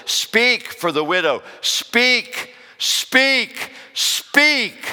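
booming, high preacher's cry for God's command; rising preacher's roar; full-force preaching. Acted. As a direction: thunder God's charge at the church, climbing each speak.

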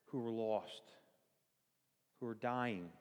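normal talking voice

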